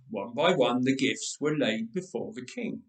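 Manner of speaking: ordinary delivery, nothing marked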